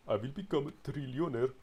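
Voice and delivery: high, lofty voiced dream of riches; playfully put-on grand voice; mock grandiose boast. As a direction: announce it like a pompous tycoon.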